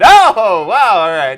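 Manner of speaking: loud, startled host reaction. Retold or imitated. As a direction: gasp it like a showy host. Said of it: "here's an episode of Talking Dead".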